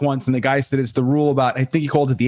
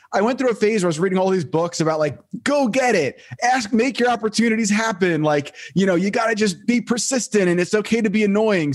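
mock pep; 7 semitones higher than usual. Mocking self-help book enthusiasm.

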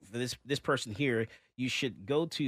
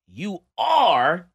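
ARE drawn up high and much louder; heavy, higher, loud stress on ARE; all-caps emphasis; 7 semitones higher, 11 dB louder than usual. Acted. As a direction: blare out "you are" as if in capitals. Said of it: "in all caps".

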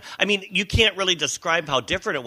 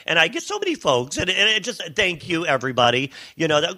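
usual speaking voice

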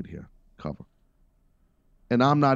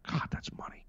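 whisper; 15 dB quieter than usual. Real, not acted. Whispered aside.

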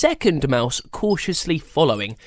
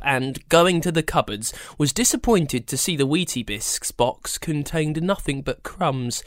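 ordinary delivery

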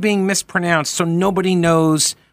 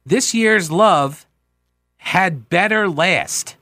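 "This year's love had better last" said slowly, phrase by phrase; deliberate correct lyric; much slower than usual. Shown as a demonstration. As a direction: spell out the lyric at half speed. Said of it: "That's what he's singing".

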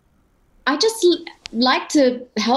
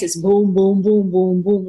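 thump out the bass like a speaker; imitated bass boom; 2 semitones lower than usual. Shown as a demonstration. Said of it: "If you put too much bass".

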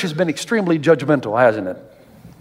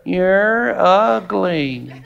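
slow, drawn-out parrot taunt through a laugh; parrot insult; much slower than usual. Retold or imitated. Parrot taunting the woman a third time, slow and laughing. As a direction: stretch it out, laughing at the joke.

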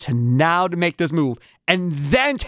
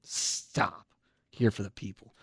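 muttered scoff; 13 semitones lower, 13 dB quieter, slower than usual. Real, not acted. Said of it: "scoffing at the quote".

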